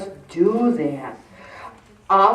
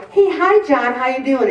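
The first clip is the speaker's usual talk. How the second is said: cheery greeting; 4 semitones higher than usual. Retold or imitated. Imitating greeting a friend who walks by, cheerily. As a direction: call out a friendly hi across the room.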